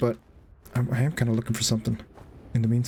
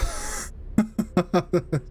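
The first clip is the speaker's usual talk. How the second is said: breathy relieved laugh; 4 semitones higher than usual. Real, not acted. Relief after the jump.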